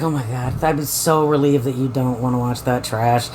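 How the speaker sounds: low, relieved put-on voice; mock relieved Carl. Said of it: "he's just like".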